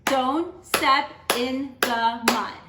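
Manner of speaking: slow, beat on every syllable; teacher clapping the last line; clapped out syllable by syllable. Shown as a demonstration. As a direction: tap out each syllable. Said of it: "Let's look at our last line".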